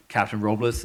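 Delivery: ordinary talk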